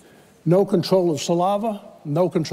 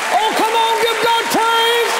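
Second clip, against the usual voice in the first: preaching at full force; 13 semitones higher than usual. Acted. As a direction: lift the congregation, shouting praise.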